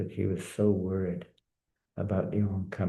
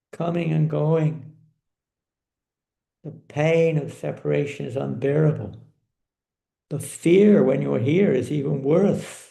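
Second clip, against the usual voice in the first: anguished protest; 7 semitones higher, 4 dB louder than usual. Acted. Queen tells her lord of separation, anguished. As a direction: pour out the hurt, pitched high.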